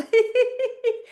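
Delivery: long, high forced laughter; deliberate high cackling laugh; high exercise laugh. Shown as a demonstration. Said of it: "Clean it with laughing".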